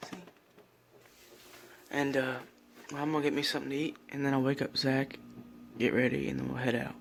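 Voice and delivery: soft early-morning whisper; hushed so nobody wakes. Real, not acted. Early morning, others asleep.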